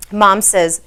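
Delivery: ordinary delivery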